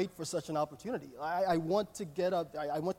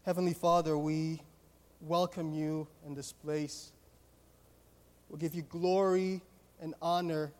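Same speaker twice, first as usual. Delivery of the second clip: public prayer; 3 dB louder than usual. Real, not acted. Leads the congregation in prayer.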